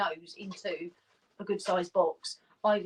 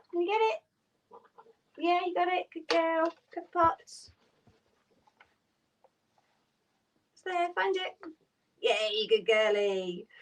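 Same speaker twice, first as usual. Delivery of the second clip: dog praise voice; 8 semitones higher than usual. Real, not acted. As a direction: cheer each dog on in a high, excited voice. Encouraging a dog to find treat.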